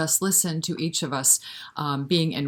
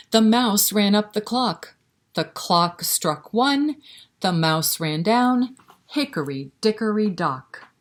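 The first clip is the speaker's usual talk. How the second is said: put-on sing-song rhyme read to little kids; lilting, rhythmic recitation for toddlers; nursery rhyme recital; 4 semitones higher, 3 dB louder, slower than usual.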